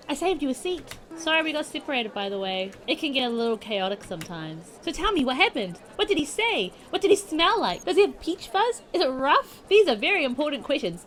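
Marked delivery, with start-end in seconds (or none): 4.8-11.0 high, loud rapid-fire questions; eager gushing. Acted. Mai grills Hana about JonTron, high eager questions. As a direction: pepper her with eager questions.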